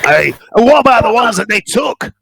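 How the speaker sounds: fussy little Scot stuck in a fire; small Scottish mycon. Acted. Fergus begging the lass to lift him out of the fire, high Scottish voice. Fergus asks about captives.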